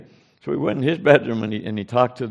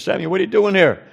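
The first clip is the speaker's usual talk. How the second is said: higher-pitched startled question; retold surprise; 8 semitones higher, 4 dB louder than usual. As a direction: imitate a chaplain caught off guard. Chaplain startled by unexpected visitors.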